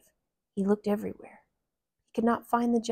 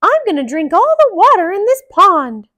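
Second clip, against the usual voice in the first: Mickey voice; 11 semitones higher, 15 dB louder than usual. Acted. Mickey speaks.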